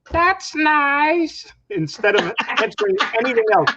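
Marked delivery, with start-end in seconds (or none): 0.1-1.5 higher, mock-polite that's nice. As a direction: parrot the catchphrase. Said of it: "we just say".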